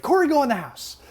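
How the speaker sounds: high, loud imitation of mother; urgent mom. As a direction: mimic mom ordering her kid away. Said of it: "and she's like".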